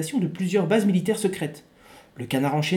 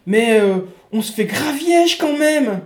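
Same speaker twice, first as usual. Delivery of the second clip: high, loud pleading soldier; whiny soldier; 6 semitones higher, 5 dB louder than usual. Acted. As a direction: plead with the chief, higher. Plays the soldier.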